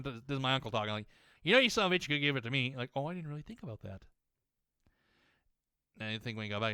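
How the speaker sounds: normal talking voice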